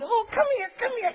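put-on high, squeaky baby talk; hammed-up doting adult; cooing at a little kid. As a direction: squeal it as if calling a toddler over. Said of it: "adults get crazy around little kids".

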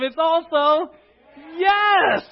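high, loud, joyful burst; with enthusiasm. Shown as a demonstration. Preacher asks the congregation for enthusiasm, excited shout. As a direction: throw it out with full enthusiasm. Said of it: "now say it with enthusiasm".

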